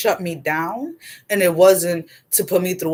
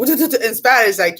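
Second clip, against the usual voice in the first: usual speaking voice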